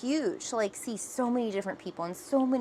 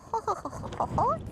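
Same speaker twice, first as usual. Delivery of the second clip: shivery laugh. Real, not acted. Stepping out into cold.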